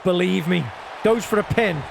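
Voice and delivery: usual speaking voice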